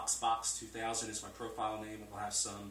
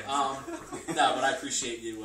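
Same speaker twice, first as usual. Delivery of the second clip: louder thanks with laughter still in it; laughing; 4 semitones higher, 10 dB louder, slower than usual. Real, not acted. Guest thanking the host, laughter fading out. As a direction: thank them while the laugh fades.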